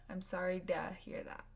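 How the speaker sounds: I'm sorry duh hear that, to reduced to a light duh. Shown as a demonstration. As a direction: swap to for a quick duh. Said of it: "Sounds almost like a duh".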